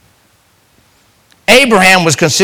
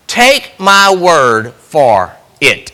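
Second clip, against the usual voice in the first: slow deliberate; much slower than usual. Shown as a demonstration.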